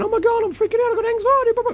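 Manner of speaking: hammed-up anxious wail, high and loud; mock panic. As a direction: squeak it out like someone freaking out. Mocking a panicking person.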